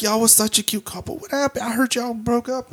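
put-on pry gently like a nosy drunk; mock drunk woman, hushed. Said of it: "she's like".

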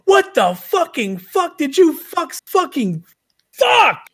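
high, slow exasperated yell; Rocco impression. Co-host re-enacting a movie character bursting in, high shouted tirade. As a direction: hammer out each curse, peaking in a shout. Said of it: "he's like".

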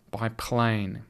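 ordinary delivery

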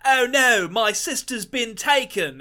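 mocking a bad English accent, high and slow. Podcast host mocking a film's child actor, bad English accent. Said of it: "a young lad who's like".

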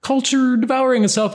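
a hammed-up fan realizing they've been had; imagined disillusioned viewer. Podcaster mimics future Space Jam viewers, in mock high outrage. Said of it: "and say".

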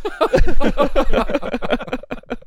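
high-pitched run of laughter; laughing. Real, not acted. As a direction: laugh at your own joke. Laughing at own joke.

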